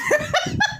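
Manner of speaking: sudden squeaky high laugh; high burst of laughter. Real, not acted. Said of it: "Don't laugh again".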